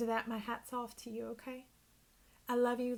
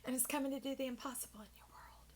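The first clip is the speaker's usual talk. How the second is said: whisper; 2 semitones higher, 8 dB quieter, faster than usual. Real, not acted. Sudden hushed emphasis in reading.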